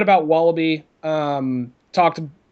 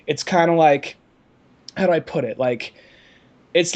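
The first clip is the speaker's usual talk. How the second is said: ordinary talk, nothing marked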